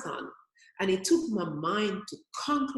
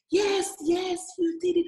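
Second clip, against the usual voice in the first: imitating a cheering spectator, higher and louder; mock cheering; 10 semitones higher, 3 dB louder than usual. Spectator cheering on marathon runners, mock excited call. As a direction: call it out like a fan on the sidelines.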